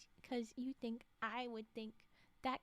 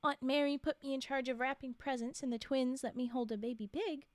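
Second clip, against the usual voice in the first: ordinary delivery, nothing marked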